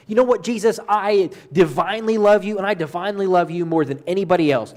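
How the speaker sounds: imitating a disciple's impulsive, loud vow; jumping in boldly. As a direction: blurt out a bold, overconfident pledge. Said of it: "jumping out there and saying".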